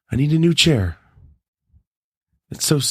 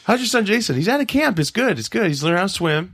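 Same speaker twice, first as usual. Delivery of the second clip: high-pitched mock small-talk voice; chatty parent; 10 semitones higher than usual. Acts out chat about son Jason.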